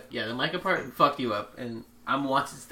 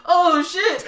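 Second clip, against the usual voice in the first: much higher, louder panicked yelp; laughing mock panic, much higher; 8 dB louder than usual. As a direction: yelp as the cross catches fire. Klansmen react to burning cross.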